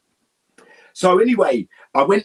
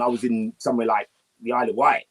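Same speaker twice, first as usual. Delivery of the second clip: normal talking voice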